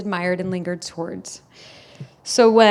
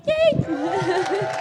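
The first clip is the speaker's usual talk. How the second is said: higher, bright celebratory cheer; cheerful cheer; 8 semitones higher, 3 dB quieter than usual. Real, not acted. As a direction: wrap up the toast with a happy cheer. Ending the toast.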